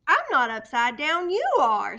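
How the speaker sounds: loud, insistent protest; indignant. A narrator's reading. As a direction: correct them firmly. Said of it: "Stellaluna said".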